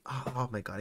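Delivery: usual speaking voice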